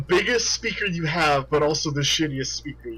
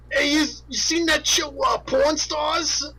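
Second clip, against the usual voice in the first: put-on New York accent; 12 semitones higher than usual. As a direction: call across the table in New York accent. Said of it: "thick New York accent".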